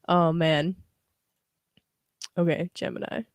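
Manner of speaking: ordinary talk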